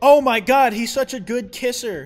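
loud, high mock swooning; exaggerated delighted exclamation; excited gushing. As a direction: burst out, thrilled and over the top. Gushes over the kiss.

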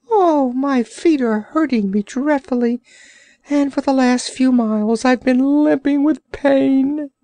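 high, pained creature whine; complaining Orc. Acted. Orc complaining to the sailor, high pained whine. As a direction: whimper about sore feet as the Orc.

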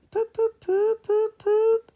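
high, loud whistle poops; engine whistle. Acted. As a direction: toot it like a train whistle.

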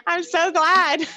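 high giggle, then slow glad words; can't stop giggling, then savour it slowly; laughing through words. Real, not acted. Instructor laughing with class.

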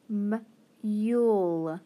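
slow, stretched m- then yule; sounding out. Shown as a demonstration. Teacher to students, slowly sounding out mule. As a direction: pull the sounds apart slowly. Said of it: "Now I have".

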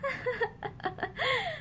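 high, soft strained laugh near tears; a brittle laugh that almost breaks; forced laugh. A narrator's reading. Wife laughing to hide her fear, high and strained. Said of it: "She laughed".

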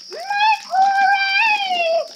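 very high, loud, sustained cheer; squealed hooray. Real, not acted. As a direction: squeal it out in celebration. Cheering in the game.

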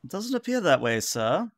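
high-pitched officer answering the captain; high, put-on crew member voice; Varissa. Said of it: "says, uh, Varissa".

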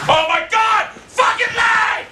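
very high, furious scream; furious shout. Real, not acted. Gamer raging at game lag, furious shout.

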